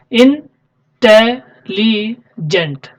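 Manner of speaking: इंटेलिजेंट drawn out very slowly, syllable by syllable; teacher modelling इंटेलिजेंट slowly; slow spoken model word. Shown as a demonstration. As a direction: stretch out इंटेलिजेंट so the class hears each syllable. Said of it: "इसमें कितने syllable आ रहे हैं".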